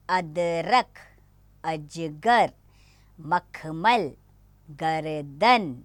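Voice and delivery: slow, each word clearly said; slow careful reading. Shown as a demonstration. Teacher reads the word list slowly for pupils. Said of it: "उच्चारण पर ध्यान देना है".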